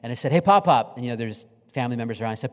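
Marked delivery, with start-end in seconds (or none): 0.3-1.0 higher, louder greeting to grandpa; cheerful call. Retold or imitated. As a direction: call out to him across the room. Calling grandfather on camera.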